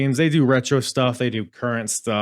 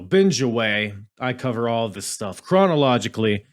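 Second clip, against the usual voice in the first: normal talking voice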